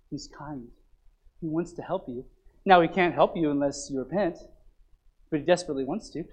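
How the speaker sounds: hushed pulpit tenderness; tender quiet preaching. Acted. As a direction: comfort them softly. Soft tender preaching continues.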